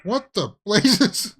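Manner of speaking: high, slow startled exclaim. Real, not acted. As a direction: caught off guard, drawn out.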